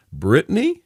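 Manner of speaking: mild gasp of recognition, voice jumping up; shocked gasp. A narrator's reading. Mother recognizing her daughter in the photo, shocked. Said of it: "she gasped".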